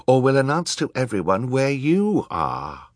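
put-on high-pitched child's version of a threat; Violet whispering threateningly. A narrator's reading. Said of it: "Violet whispered threateningly".

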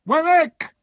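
very high, loud call; loud, high-pitched summons; crying out. A narrator's reading. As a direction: call out sharply for the clerk. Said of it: "Mister Jaggers cried".

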